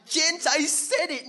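high, loud scandalised crowd chatter; shocked crowd. Acted. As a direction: gossip in outrage as the crowd. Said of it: "The crowd are like".

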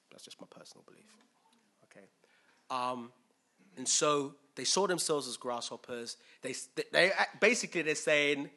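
ordinary talk

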